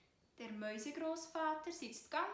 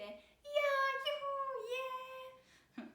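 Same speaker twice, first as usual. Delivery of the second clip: high, loud squeaky cheer; cheering mice; 14 semitones higher, 7 dB louder, much slower than usual. A narrator's reading. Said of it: "So rufen dann die kleinen Mäuse". (hoher, lauter Jubel der Mäusekinder)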